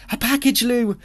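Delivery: put-on thrilled like a kid on parcel day; eager excitement. A narrator's reading. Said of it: "his gleaming eyes would have said".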